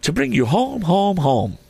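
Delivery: put-on very high, excited child voice; high-pitched childish delight; gleeful little girl. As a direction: sing out the good news like a small sister. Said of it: "bending down to laugh".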